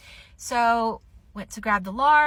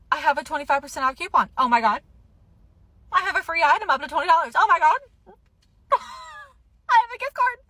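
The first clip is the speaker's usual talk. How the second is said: fast, higher and louder with glee; giddy excitement; 7 semitones higher, 4 dB louder, faster than usual. Real, not acted. Shopper lists her coupons, fast and giddy. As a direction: rattle off each deal, thrilled.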